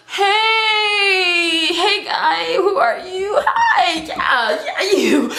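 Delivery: high, drawn-out comic hailing; hammed-up "yoo-hoo" call. Acts calling out to man.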